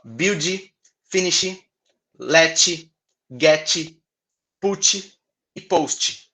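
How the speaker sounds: "build", "finish", "let", "get", "put", "post" with an extra i at the end; teacher shows how Brazilians say these verbs in everyday talk; Brazilian-style verbs. Shown as a demonstration. Slow list of verbs with an i added to each one. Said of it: "a forma como a gente conversa".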